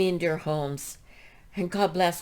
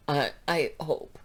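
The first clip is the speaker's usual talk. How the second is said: usual speaking voice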